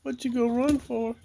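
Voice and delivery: high teasing voice; high, teasing question to the chicken. Real, not acted. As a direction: playfully question the hen. Teasing hens.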